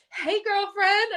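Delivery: high, drawn-out fake-happy greeting; cheerful facade. Hiding tears from a friend, put-on cheerful. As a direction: greet brightly as if nothing is wrong. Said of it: "put on the little facade".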